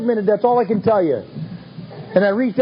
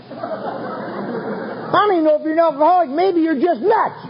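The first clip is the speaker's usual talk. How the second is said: higher and slow, a light take on the sponsor; slowly, in a pitched-up sponsor voice, call him nuts; sponsor's voice; 8 semitones higher, slower than usual. Retold or imitated. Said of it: "He said".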